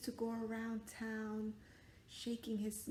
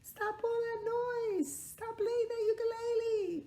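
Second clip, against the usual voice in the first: high, whiny scolding; annoyed townswomen; 5 dB louder, slower than usual. Acted. Townswomen scolding the boy for his noise. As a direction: shriek at the noisy boy. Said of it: "the women in town were like".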